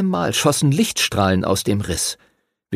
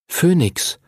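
put-on higher, sure boyish reply; Henry answering; 7 semitones higher than usual. A narrator's reading. Henry names the young dragon, instant sure reply. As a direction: answer at once without thinking. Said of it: "antwortete Henry, ohne nachzudenken".

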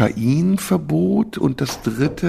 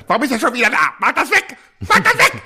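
panicked squeal rising ever higher and louder; shaking off a spider; 11 semitones higher, 5 dB louder, faster than usual. Retold or imitated. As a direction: shriek like something crawls on you. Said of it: "Also die sagen".

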